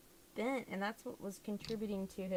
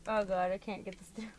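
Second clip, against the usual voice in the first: ordinary delivery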